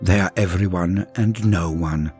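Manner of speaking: ordinary delivery, nothing marked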